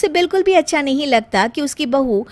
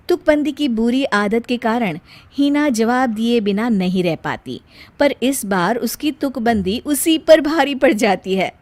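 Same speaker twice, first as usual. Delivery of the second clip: usual speaking voice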